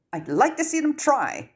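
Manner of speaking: a high, sharp snap; snapped. Acted. Ginny snaps back at the Ministry's plan. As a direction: bite the words off, defiant. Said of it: "Ginny snapped".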